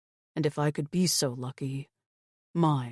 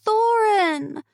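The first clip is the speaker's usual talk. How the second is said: put-on high, loud child's cry; children crying out; 7 dB louder than usual. A narrator's reading. Two girls crying out the name together, loud high child voices. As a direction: call the name out like a scared kid. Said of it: "both girls cried in unison".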